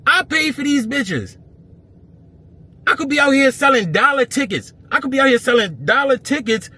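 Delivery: real outrage yelled at the promoter who called him a problem; furious rant. Real, not acted. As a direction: vent like you've been robbed after doing him a favour.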